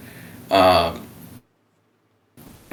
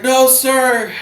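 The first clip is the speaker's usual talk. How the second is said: meek reply; 4 dB louder than usual. Retold or imitated. His own meek reply.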